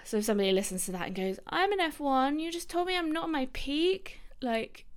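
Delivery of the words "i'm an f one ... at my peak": mock offended junior doctor.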